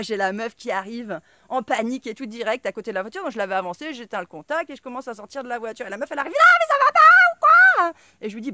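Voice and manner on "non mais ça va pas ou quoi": mock outraged woman, much higher and louder; mocking shrill woman. Imitating the angry car owner screeching at me. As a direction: mimic her screeching at me.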